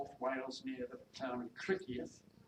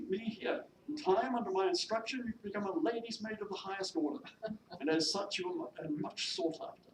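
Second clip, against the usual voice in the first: high, loud, lecturing lady employer; self-important mistress; 7 semitones higher, 5 dB louder than usual. Acted. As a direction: persuade the maid from a position of authority. Mistress persuades maid to stay.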